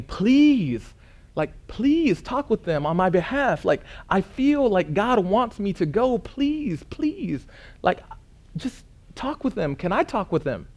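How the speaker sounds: high pleading voice, close to tears; retold begging. Nineteen-year-old begging the mission board on the phone, high tearful pleading. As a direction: beg them to let you go. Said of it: "like, weeping, like, begging".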